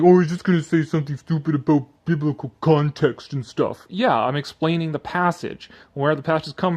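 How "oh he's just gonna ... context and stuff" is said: lightly voiced sneering commenter; a hint of a dismissive internet atheist; mocking commenter. Youtuber mocks his commenter, mild sneering voice.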